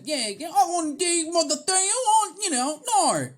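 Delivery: very high, obnoxious Australian punk yelp; snotty Australian punk. Acted. Imitating Australian punk bands, snotty high Australian accent. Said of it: "like, snotty and obnoxious".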